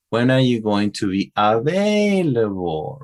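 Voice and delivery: WH-question tune, AVAILABLE rising and dropping; up-and-down question intonation. Shown as a demonstration. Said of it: "that's how the intonation, uh, uh, goes up".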